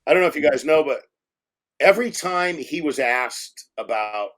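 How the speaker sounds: normal talking voice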